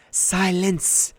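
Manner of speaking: loud, high snarl. A narrator's reading. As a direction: cut her off viciously. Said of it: "Medusa snarled".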